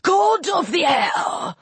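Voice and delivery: slow, high hissing shout. A narrator's reading. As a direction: hiss out his title. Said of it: "Shu hissed".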